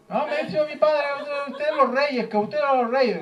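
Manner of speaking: put-on high, whiny kid voice; put-on child. Boy asks the neighbour for presents, high child voice. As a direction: plead in a high little boy's voice.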